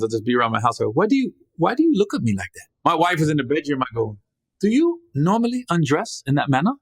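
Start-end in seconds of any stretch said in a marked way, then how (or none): 0.9-2.7 higher-pitched mock African accent; bad African accent. 4.6-6.7 raised, formal mock African accent; terrible African accent. Husband to wife in the bedroom, terrible mock African accent. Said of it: "My accent's terrible".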